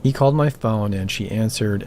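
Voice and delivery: usual speaking voice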